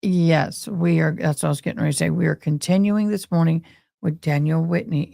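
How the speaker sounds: normal talking voice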